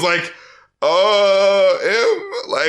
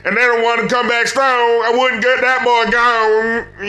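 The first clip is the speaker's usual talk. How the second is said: awful mock Southern accent; 3 semitones higher than usual. Co-host mocking forced rhymes, awful Southern accent. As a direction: force every rhyme in a bad Southern twang. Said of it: "those awful accents to help him force those rhymes".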